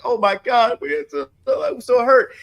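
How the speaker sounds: hammed-up sobbing fan lament; mock whiny fan. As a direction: whine like a crushed college kid. Mocking college fans whining over loss.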